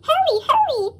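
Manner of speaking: high, eager call to hurry; excited coaches calling. Acted. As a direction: urge everyone aboard, pitched high. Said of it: "they called".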